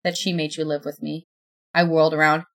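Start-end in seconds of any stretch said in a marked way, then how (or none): 0.0-1.3 flat, emotionless voice. Acted. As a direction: say it with no feeling at all. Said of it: "still in that same flat tone".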